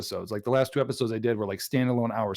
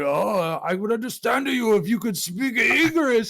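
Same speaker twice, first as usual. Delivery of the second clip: high-pitched cartoon Japanese accent; hammed-up Japanese accent, taunting; mock Japanese accent; 9 semitones higher, slower than usual. Rival needling the owner about English, mock Japanese accent. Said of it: "the Japanese dude looks at him".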